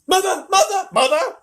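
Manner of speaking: high, loud, repeated calls; shouting. A narrator's reading. Boy bursts through the door, shouting for mother. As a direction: burst in calling at full voice.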